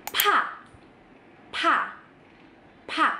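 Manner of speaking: "pa" as high, breathy "pha"; strongly aspirated P. Shown as a demonstration. Teacher demonstrating the last letter, strong puff. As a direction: blast "pa" out with a big H. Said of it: "ada haknya banget cara bacanya".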